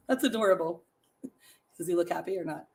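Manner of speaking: ordinary talk, nothing marked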